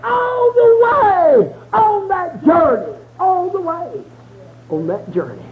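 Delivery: rising into a sung whoop, cry in the voice; preaching. Acted. Preacher whooping to the congregation, crying out. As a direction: preach it, building to a tuneful shout.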